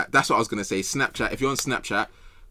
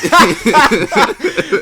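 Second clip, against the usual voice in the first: high, loud burst of laughter; 13 semitones higher, 12 dB louder than usual. Real, not acted. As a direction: explode laughing. Cracks up at own point.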